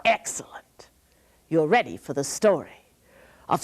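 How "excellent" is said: whisper. Real, not acted. Praising kids in a whisper.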